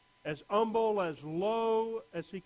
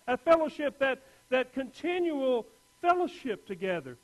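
rising, louder sermon delivery; preaching; 6 semitones higher, 4 dB louder than usual. Acted. Pastor preaching to the congregation, building fervour.

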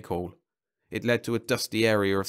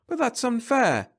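yell; 4 dB louder, slower than usual. A narrator's reading. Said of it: "yelled Jeb".

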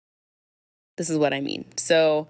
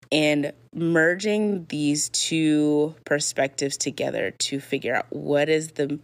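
ordinary talk

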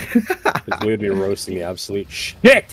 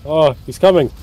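higher, louder alarmed cry; panicking gamer; 7 semitones higher, 8 dB louder than usual. Real, not acted. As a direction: yelp the warning in alarm. Boss charging at them.

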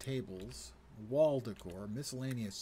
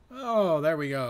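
higher, louder burst of relief; sudden pleased exclamation; relieved yelp; 7 semitones higher, 15 dB louder than usual. Real, not acted. Streamer yelping as the stash box finally snaps down.

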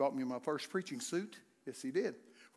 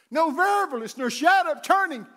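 higher, louder proclaimed phrase; loud scripture proclamation; 11 semitones higher, 11 dB louder than usual. Acted. Preacher repeating a verse, loud proclamation. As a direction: call the phrase out to the church.

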